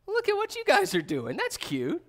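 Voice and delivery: put-on like watching kids play-fight; God amused. God mocking the nations who plot against him, amused.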